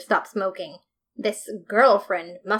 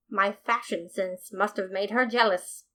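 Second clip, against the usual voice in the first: plain reading voice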